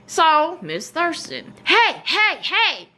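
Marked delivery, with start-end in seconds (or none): 1.6-2.9 high, sharp teacher's call down the hall; the teacher calling after me in the hall, high and insistent. Retold or imitated.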